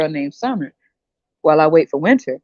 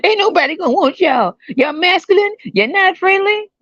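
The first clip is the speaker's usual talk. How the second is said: mock woman telling men nobody wants them, high; 12 semitones higher than usual. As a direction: pitch it up and draw out the insult. Voicing women insulting him.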